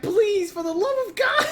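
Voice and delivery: high, cracked mock crying plea; mock sobbing plea. Host voicing Austin crying to the card, mock sobbing. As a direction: wail it, hammed-up despair. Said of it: "He said".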